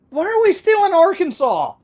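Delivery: high, full-voiced exasperated yell; incredulous shout. Real, not acted. Viewer fed up with Arkansas after the charges, shouting incredulously. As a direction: holler the question in disbelief. Outburst at the repeat offences.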